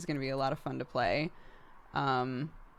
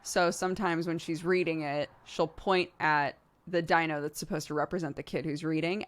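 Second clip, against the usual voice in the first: ordinary talk, nothing marked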